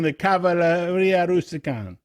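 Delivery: high, sing-song Jersey-Italian; mock Italian accent. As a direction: roll out the title in hammed-up Italian.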